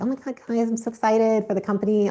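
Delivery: mock a cheerleader boss faking excitement; mock fake enthusiasm. Speaker mocking a fake-hyped leader, mock gushing. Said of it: "woo-woo fake energy".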